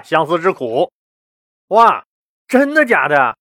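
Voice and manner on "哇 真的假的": high, wide-eyed disbelief; amazed emperor. A narrator's reading. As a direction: gasp it out as an astonished question. Emperor amazed at conjurer's claim.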